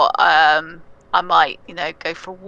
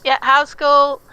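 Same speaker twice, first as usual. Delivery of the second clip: mum voice; 7 semitones higher, 5 dB louder than usual. Retold or imitated. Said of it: "and she'd be like".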